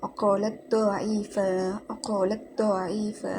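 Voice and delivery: slow, drawn-out "তাইফা" with a final h in place of t; round ta as ha. Shown as a demonstration. Teacher repeating the pausing rule for round ta, final ha sounded. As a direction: recite "তাইফা" slowly, ending on h. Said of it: "গোল তা থাকলে সেটা হা ধরে".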